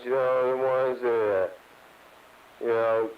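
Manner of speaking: normal talking voice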